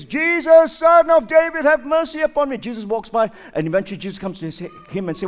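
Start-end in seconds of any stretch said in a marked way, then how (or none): 0.0-2.6 higher, louder crying out for mercy; blind beggar's cry. Acted. Blind Bartimaeus calling to Jesus, pleading cry. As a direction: call out desperate from the roadside. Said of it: "he hears Jesus walking by and says".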